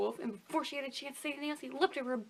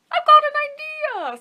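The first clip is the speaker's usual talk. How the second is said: eager wolf; 16 dB louder than usual. Acted. Wolf's eager suggestion.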